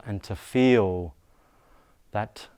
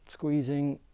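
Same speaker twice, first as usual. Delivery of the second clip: tensed-body strain; 5 semitones higher than usual. Shown as a demonstration. Tensing body on held breath.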